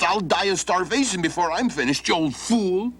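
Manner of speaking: a touch of storybook anger; mild acted anger. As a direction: snap at the old fool, hungry and fed up. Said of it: "you sound angry".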